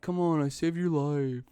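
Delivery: jokingly guilt-trip your brother; mock pleading. Older brother begging Hank for food, mock pleading.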